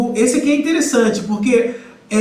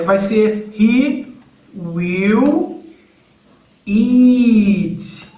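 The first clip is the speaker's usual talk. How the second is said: he, will, eat spaced out slowly with pauses; slow model phrase; much slower than usual. Shown as a demonstration. Teacher slowly modelling he will eat for beginners. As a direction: drag out each word for students.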